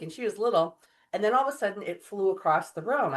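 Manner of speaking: ordinary delivery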